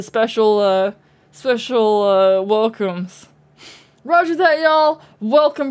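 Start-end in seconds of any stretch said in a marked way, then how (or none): 4.0-5.7 loud high cheer; excited shout. Real, not acted. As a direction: call out the greeting. Greeting subs.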